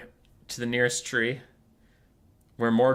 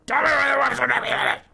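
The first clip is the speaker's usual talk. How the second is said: very high, fast screech; exasperated demand; 13 semitones higher, 4 dB louder, faster than usual. Acted. Skrulk repeating himself to Morgar who can't hear, frantic screech. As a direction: screech it so he hears. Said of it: "I can't hear you".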